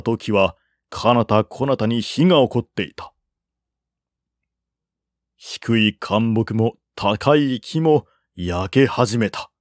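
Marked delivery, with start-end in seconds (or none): none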